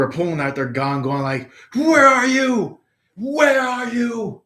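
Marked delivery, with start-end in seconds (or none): 1.7-2.8 high, slow, louder demand; hammed-up menacing call; angsty hunt. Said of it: "getting all angsty". 3.1-4.4 high, slow mock shout; hammed-up searching yell; angsty shout. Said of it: "getting all angsty".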